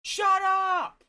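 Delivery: high, very loud yell; fed-up outburst at full voice; exasperated shout. Real, not acted. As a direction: shout down a nagging voice. Yells at the nagging game voice.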